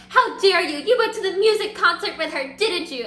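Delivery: loud, high mock outrage; staged accusation. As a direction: act out a jealous lovers' quarrel. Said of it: "so she starts this whole bit".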